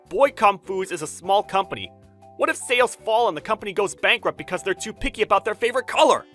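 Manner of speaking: loud, fast, high rant; heated argument. Acted. Designer protesting the client's wish to his boss, heated argument. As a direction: build to an exasperated outburst.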